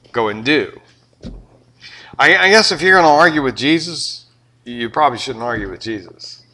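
ordinary delivery

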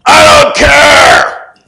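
very high, slow, shouted reply; God's loud answer. Retold or imitated. Preacher voicing God's reply to his prayer, slow booming shout. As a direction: boom it out, drawn out and loud. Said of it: "I heard him say".

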